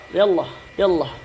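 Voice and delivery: quick native Arabic "ya Allah, ya Allah". Shown as a demonstration.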